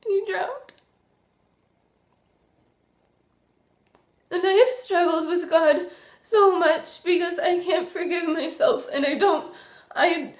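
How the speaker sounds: crying through the words; tragic heroine sorrow. Real, not acted. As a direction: voice breaking into tears, high and strained. Confessing her little brother drowned.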